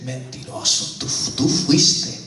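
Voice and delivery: low, whispery, slow accusation; hushed conscience, dragging out the words; inner voice of conscience. Acted. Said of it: "esa voz que te dice".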